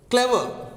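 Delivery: "clever" with the first syllable punched high, falling away; stress on first syllable. Shown as a demonstration. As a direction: hit the start of "clever", let the end drop. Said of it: "when you're giving stress at this k".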